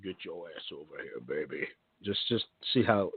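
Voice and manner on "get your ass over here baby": very low, hushed come-on; mock deep-voiced nurse impression; pretend nurse voice. Shown as a demonstration. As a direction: drop it deep and soft for a creepy nurse. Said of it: "Do that voice and pretend like you're a nurse".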